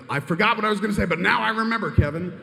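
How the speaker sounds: high, loud stylized outburst; imitating an actor's performed indignation; theatrical anger with panache. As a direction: turn on him with a showy, put-on flourish. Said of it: "put a little bit of panache performance".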